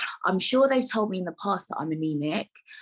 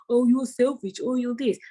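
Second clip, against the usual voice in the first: higher, louder mock scolding; mimicked accusation; 6 semitones higher, 6 dB louder than usual. Everybody cutting off the unvaccinated, mimicked accusation. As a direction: imitate a crowd pointing the finger.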